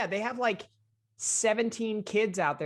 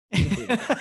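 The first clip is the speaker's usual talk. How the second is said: a light, higher mock Dan voice saying "He's a burrito guy."; imitating Dan; 6 semitones higher, 3 dB louder, much faster than usual. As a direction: echo Dan's catchphrase a bit higher, half-joking. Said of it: "As Dan has said many times".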